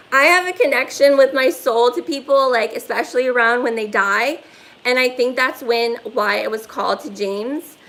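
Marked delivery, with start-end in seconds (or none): none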